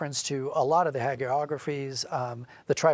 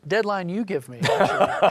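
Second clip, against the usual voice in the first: laughing; 6 semitones higher, 5 dB louder than usual. Real, not acted. Joking about script deadlines.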